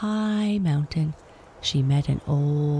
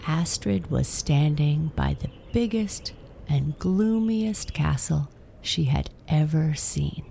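ordinary delivery, nothing marked

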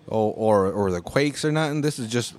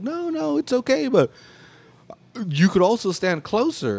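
much higher, breezy imitation of the guy; mock placating guy; 9 semitones higher than usual. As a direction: brush it off, lightly mocking. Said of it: "He's like".

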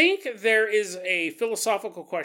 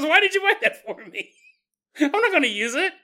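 put-on higher, louder bored-teen whine; teen daughter; 8 semitones higher, 7 dB louder than usual. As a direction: whine it like a dismissive teenage daughter. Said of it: "She goes".

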